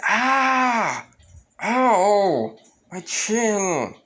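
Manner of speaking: high yelping ow of pain; sharp cry of pain, then a whine. Acted. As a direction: yelp like you just got smacked in the chin. Naruto hit in the chin.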